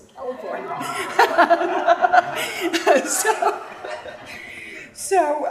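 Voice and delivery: a sudden loud burst of laughter; laughing. Real, not acted. Teasing Eva in front of the audience, laughing. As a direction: laugh off the cheeky remark. Joke about remote California.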